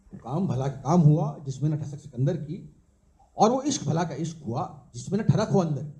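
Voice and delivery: measured, rhythmic verse with a lilting refrain; live poetry recital; poem recitation. Acted. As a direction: recite it as a couplet, landing on each rhyme.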